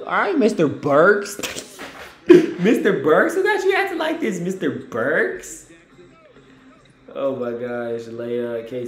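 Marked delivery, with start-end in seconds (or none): 0.1-5.7 slow, drawn-out, mock-formal teasing; teasing praise. Real, not acted. Praising Alec Burks's floater, slow teasing drawl. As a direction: stretch out Mister Burks playfully.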